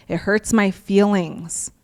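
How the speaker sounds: normal talking voice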